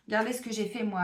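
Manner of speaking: ordinary delivery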